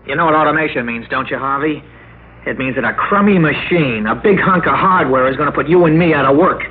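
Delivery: angry rant. Acted. Jack's angry preview teaser.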